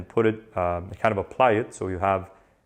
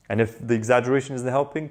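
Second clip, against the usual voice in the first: normal talking voice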